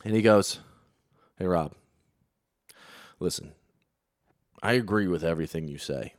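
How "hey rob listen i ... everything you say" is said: hushed aside. Retold or imitated. Retold comedian confiding quietly.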